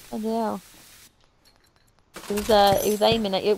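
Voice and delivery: ordinary talk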